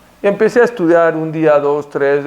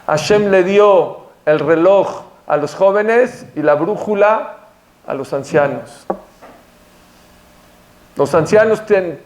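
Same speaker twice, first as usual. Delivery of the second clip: ordinary delivery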